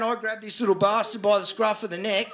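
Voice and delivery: ordinary delivery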